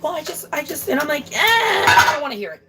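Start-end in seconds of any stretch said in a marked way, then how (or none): 0.0-0.9 higher, softer faltering mom voice; imitating mom. Mom starting an excuse, imitated high and soft. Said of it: "she's like". 1.3-2.6 higher-pitched cutting-off noise, then refusal; mock dismissive. Kid shutting down mom's excuse, retold with high mock impatience. Acted quarrel, cutting mom off.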